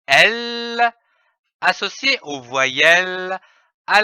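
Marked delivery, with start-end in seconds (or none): 0.1-0.9 a high, steady L held on the tongue; "L" held as a pure consonant sound, tongue raised. Shown as a demonstration. Teacher sounding out the consonant for pupils, high and held. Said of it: "avec le son de la consonne".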